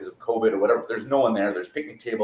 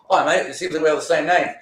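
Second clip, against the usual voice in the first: light mock Australian accent, fast; a quick, faint Aussie accent; 6 semitones higher, 4 dB louder, faster than usual. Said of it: "why he had an Australian accent".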